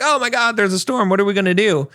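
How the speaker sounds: high, hammed-up panicked cry of a frightened crew; mock crew panic. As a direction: squeal it out like a sailor spotting the storm. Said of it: "the crew kind of freaks out".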